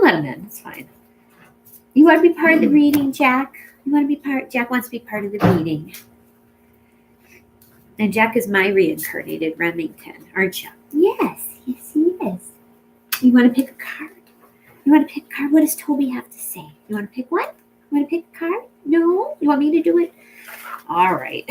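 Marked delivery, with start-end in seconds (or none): 1.9-4.5 higher-pitched coaxing of a pet; pet talk. Real, not acted. Reader asks her pet Jack to join the reading, pet talk. 10.9-20.1 high sing-song questions to an animal; pet talk. Real, not acted. Reader coaxing her pet to pick a card, pet talk.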